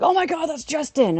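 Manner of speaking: high-pitched mock amazement; uncle freaking out. Imitating uncle spotting coworker in photos, high excited voice. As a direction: imitate an excited, star-struck relative. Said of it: "my uncle kind of freaked out".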